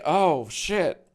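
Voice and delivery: ordinary talk, nothing marked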